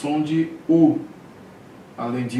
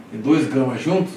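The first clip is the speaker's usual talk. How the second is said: usual speaking voice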